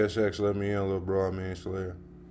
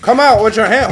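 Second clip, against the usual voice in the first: higher and louder, fed up; angry outburst; 8 semitones higher, 4 dB louder than usual. Real, not acted. Streamer demanding the intruders come out, fed-up loud calls. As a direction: yell out at whoever is causing chaos. Players messing up his room.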